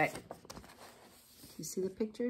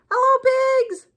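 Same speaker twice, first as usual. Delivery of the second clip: cheery duck voice; 10 dB louder, faster than usual. A narrator's reading. Duck calling out to Cow as he rides past, cheery duck voice. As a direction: call out high and loud as a little duck. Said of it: "said Duck".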